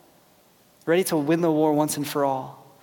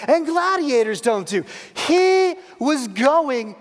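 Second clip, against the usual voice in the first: high, driving sermon delivery; impassioned preaching; 9 semitones higher, 5 dB louder than usual. Acted. Preacher telling the church why the Messiah came, impassioned preaching. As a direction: preach it in rising, punched phrases. Preaches climax at full force.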